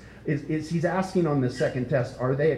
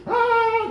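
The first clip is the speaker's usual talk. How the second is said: orc cheer; 8 dB louder than usual. Retold or imitated. Orcs cheering about going to war.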